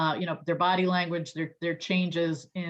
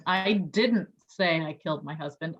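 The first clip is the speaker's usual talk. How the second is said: "didn't" lifted and leaned on, the rest even; stress on DIDN'T. Shown as a demonstration. Sales trainer demonstrating emphasis on each word, stress on "didn't". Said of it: "putting the emphasis on each word".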